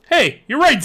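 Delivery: high loud burst of realization; excited cry. Acted. Zeke realizing the door pulls open, excited cry. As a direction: blurt it out excitedly. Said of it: "Zeke cried".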